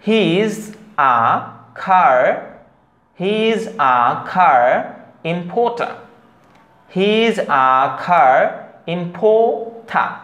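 very slow separated words, "car" as khaa, "importer" as im-pho-tha; drawn-out model of "he is a car importer", puffed k, p, t, r's dropped in importer; correct pronunciation. Shown as a demonstration. Teacher drilling learners, slow "car importer" with aspirated consonants. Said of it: "তাহলে আমরা সঠিক উচ্চারণ হবে".

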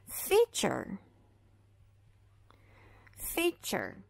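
feature with ch sound; fee-cher, t as ch; English model word. Shown as a demonstration. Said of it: "esse T vai ser pronunciado como C H".

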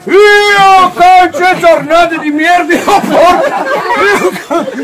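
furious shouted swearing. Acted. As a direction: spit the curse out in fury. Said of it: "butta lì una bestemmia".

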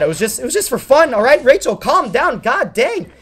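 acted-out exasperated begging to calm down; frantic pleading. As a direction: plead urgently, voice climbing. Retold frantic plea to calm her.

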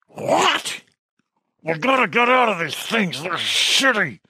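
high, strained growling speech; werewolf growl. A narrator's reading. Werewolf couple complain about the costumes, growling high and garbled. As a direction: push words out through a beast's muzzle. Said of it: "Her reply was an indistinct growl".

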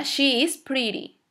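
slow, spaced SHE IS PRETTY, falling; model sentence for repeating. Shown as a demonstration. Teacher has students repeat after her, slow model sentence. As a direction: draw out SHE IS PRETTY for learners. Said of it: "repitan después de mí".

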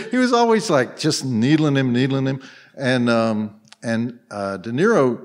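usual speaking voice

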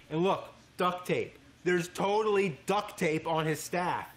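loud, high mocking amazement; excited outcry. Real, not acted. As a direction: call it out loudly, drawing out the find. Spotting duct tape on costume.

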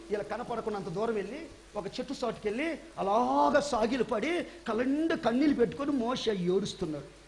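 ordinary talk

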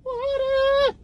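high, slow, shouted whine; hammed-up thirsty whine for water; whiny demand. Boyfriend demanding his water from the girlfriend, whining high.

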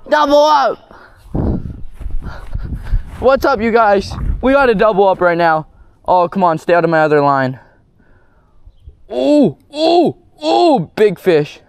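fighting a fish. Real, not acted. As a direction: call it out while reeling hard. Fighting two fish at once.

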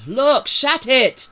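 loud, high, slow bellow; silencing shout. A narrator's reading. Bryce silences the arguing crew, shouting. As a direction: shout them down, word by word. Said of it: "Bryce quieted them all".

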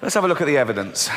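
ordinary talk, nothing marked

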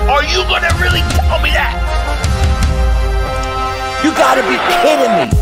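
ordinary delivery

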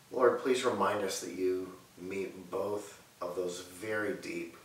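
ordinary talk

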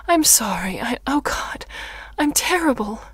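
loud, high, guilt-stricken apology; upset self-reproach, voice lifted; distraught. Acted. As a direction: blurt it out in distress. Mother breaks down apologizing.

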